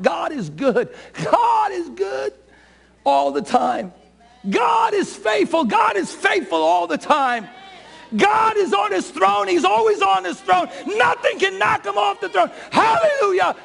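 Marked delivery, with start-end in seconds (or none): 1.2-3.9 triumphant shout. Shown as a demonstration. Preacher shouts to the congregation, high triumphant shout. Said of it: "shout unto God with a voice of triumph". 4.5-13.6 triumphant shout. Shown as a demonstration. Preacher shouts praise to the congregation, high triumphant shout. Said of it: "shout unto God with a voice of triumph".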